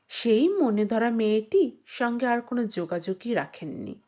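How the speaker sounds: ordinary delivery, nothing marked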